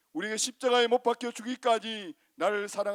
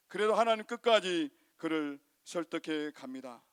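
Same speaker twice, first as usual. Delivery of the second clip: ordinary talk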